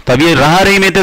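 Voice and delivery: ordinary delivery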